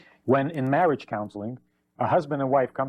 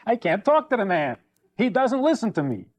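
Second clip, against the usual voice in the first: lightly acted exasperation, higher pitch; exasperated wife; 5 semitones higher than usual. Wife gives up on talking to husband, exasperated. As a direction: lift the voice in mild mock despair.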